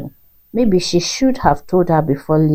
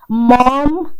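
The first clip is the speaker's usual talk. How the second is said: loud high cry of protest; embarrassed scream; 8 semitones higher, 5 dB louder than usual. A narrator's reading. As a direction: yell Mom! to cut her off. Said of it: "Ethan screamed".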